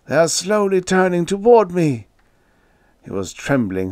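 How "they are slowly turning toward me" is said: high, loud cry of dread; panicked, raised voice; terrified shriek. A narrator's reading. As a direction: shriek as the danger closes in. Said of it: "he shrieked".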